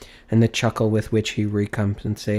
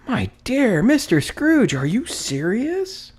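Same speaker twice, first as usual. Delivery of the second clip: high, slow, disbelieving question; astonished; 4 dB louder, slower than usual. A narrator's reading. The gentleman hearing Scrooge's gift, slow and incredulous. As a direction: stretch it out in amazement.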